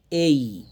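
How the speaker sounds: A said as a long gliding "ey-ee"; A as diphthong. Shown as a demonstration. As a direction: draw out A, gliding into "ee". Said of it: "আমরা এ বলবো না".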